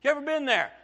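usual speaking voice

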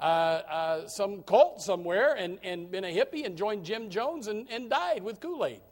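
ordinary talk

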